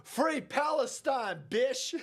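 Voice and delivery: high-pitched, drawn-out imitation of a protester; mock protest cry; mock shouting. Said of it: "she shouts while ripping".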